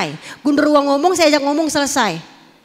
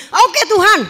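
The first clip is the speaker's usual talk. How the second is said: eager reply; 7 semitones higher, 6 dB louder than usual. Retold or imitated. Eager answer.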